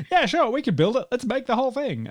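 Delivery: high, breezy yes-man enthusiasm; imitating easygoing, high-pitched keenness; eager mock agreement. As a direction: say it like a producer happily green-lighting everything. Imagined producers' eager reply, acted.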